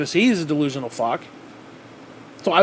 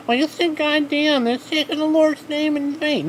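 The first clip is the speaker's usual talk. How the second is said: pious scold; 11 semitones higher than usual. Retold or imitated. Vlogger mocks Christians scolding his swearing, high and prissy. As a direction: play a prim churchgoer tut-tutting. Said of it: "all these people".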